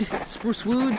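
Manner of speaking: squeaky high repeat; high silly voice. Acted. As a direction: sing it up high like a cartoon. Repeating it even higher.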